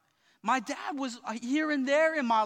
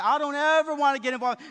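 mocking churchgoer; 3 semitones higher, 8 dB louder than usual. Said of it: "people say".

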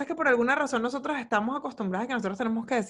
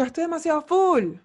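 "full" hit higher and louder in mock exasperation; mock fed-up outburst, higher and louder; exasperated complaint; 6 semitones higher, 5 dB louder than usual. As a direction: blurt "Estoy demasiado full" like you are fed up. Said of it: "a veces digo".

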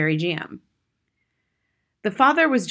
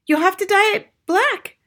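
mother; 13 semitones higher, 6 dB louder than usual. A narrator's reading. Mother instructs her husband.

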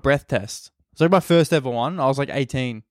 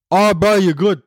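loud, higher put-on cop voice; exaggerated dismissive cop; intimidating cop; 5 semitones higher, 5 dB louder than usual. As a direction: mimic an odd, overbearing officer. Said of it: "Why are you talking like that?".

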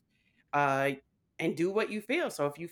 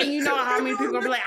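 rushed lead-in; 6 semitones higher, 5 dB louder, much faster than usual. Real, not acted. Fast lead-in.

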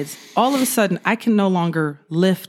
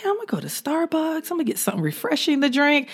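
much higher, breezy mock cheer, softer; mock chipper; 8 semitones higher, 3 dB quieter, faster than usual. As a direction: chirp it like a naive rookie planning her coffee. Mocking her own morning plans.

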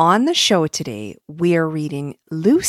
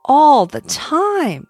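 slow, louder little star; sparkly tinkling star voice; 9 semitones higher, 7 dB louder, much slower than usual. Acted. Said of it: "said the star".

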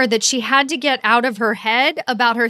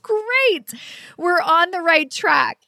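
excited; 6 semitones higher, slower than usual. Retold or imitated. Retelling her delighted reply.

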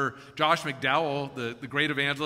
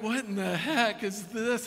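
slow, higher, drawn-out disbelief; acted slow incredulity; baffled burglar; 6 semitones higher, slower than usual. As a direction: stretch it out in mock bewilderment. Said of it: "he's like".

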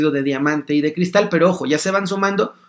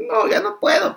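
put-on much higher, small worn-out child voice; overwhelmed child. Child overwhelmed by a noisy party pleads, much higher.

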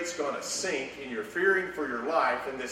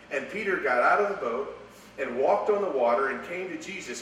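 ordinary delivery

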